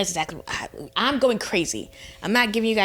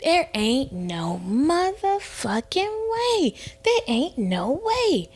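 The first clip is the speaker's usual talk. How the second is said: slow, high, stretched-out indignation; outraged disbelief; 7 semitones higher, slower than usual. Retold or imitated. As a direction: drag out every word in sheer disbelief. Acts out her drunken disbelief.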